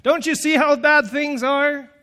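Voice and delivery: high, loud frustrated shout; exasperated yell. Retold or imitated. Throttling the happy people, shouting in frustration. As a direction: shake them with your voice. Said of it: "grab their throats and go".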